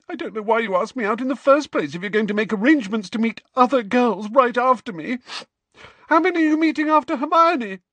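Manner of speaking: put-on high, fast, loud crying girl; tearful rant. A narrator's reading. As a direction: rush it out through tears. Cho crying into napkin.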